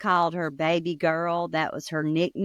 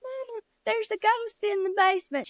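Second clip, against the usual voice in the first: high, breathless panic; scared boy; 13 semitones higher than usual. Acted. Son runs upstairs to mama, high frightened cry. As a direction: squeal it to mama like a scared kid.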